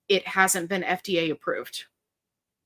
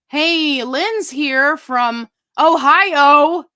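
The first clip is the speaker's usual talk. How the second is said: loud, drawn-out mock yell on Ohio; big hollered shout-out; playful yelling; 6 semitones higher, 9 dB louder, much slower than usual. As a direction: shout out a greeting, stretching the words high. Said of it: "Lynn was yelling at me".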